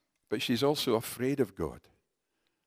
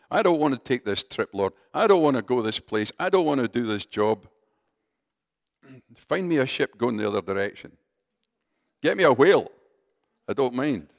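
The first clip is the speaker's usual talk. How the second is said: louder, protesting Jonah pleading with God; reluctant Jonah; 7 dB louder than usual. Retold or imitated. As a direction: dig in your heels, begging Lord. Preacher voices a reluctant Jonah.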